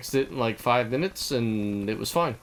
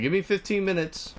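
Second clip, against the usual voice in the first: a higher, called-out line across the office; mock yell; 6 semitones higher than usual. Calling out to coworkers down the aisle, mild mock yell. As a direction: call it out over the cubicles, lightly. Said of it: "Like, I'm yelling this out".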